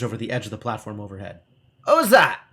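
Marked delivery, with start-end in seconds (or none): 1.8-2.4 high, loud crabby snap at a stranger; an old hermit barking who's there; crabby demand. Acted. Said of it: "it demands crabbily".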